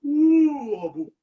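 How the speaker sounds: high, loud drawn-out "ooh", dropping off; imitated wobbly "ooh". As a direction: mimic a stunned fighter's long high ooh. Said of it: "Chandler just go".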